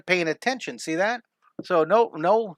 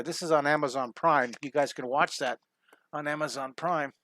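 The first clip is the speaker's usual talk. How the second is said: normal talking voice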